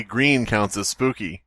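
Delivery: ordinary talk, nothing marked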